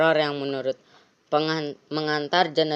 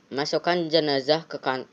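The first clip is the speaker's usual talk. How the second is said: ordinary talk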